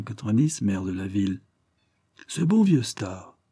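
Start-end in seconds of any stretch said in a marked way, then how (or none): 2.3-3.4 high, slow fond exclamation; exclaiming. A narrator's reading. Visitor fondly recalling old Starr, slow high exclamation. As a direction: draw it out, raised and delighted. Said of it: "s'écria notre visiteur".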